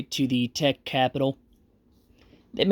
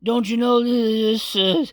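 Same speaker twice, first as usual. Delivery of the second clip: put-on high, slow slurred drunk voice; a drunk samurai in a higher voice; 8 semitones higher, slower than usual. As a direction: slur it like a drunk. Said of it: "And he says".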